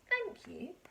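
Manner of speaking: high squeaky thank you; squeaked thanks. Acted. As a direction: squeak it like baby mice. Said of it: "they squeaked".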